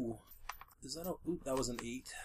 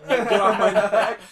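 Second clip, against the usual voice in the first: louder, higher, laughing protest; high laughing cry; 5 semitones higher, 11 dB louder than usual. Acted. Echo of the mock struggle.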